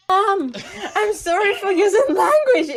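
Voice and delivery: loud, high, laughing apology; real laughter. Real, not acted. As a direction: crack up while apologizing. Cracking up at the result.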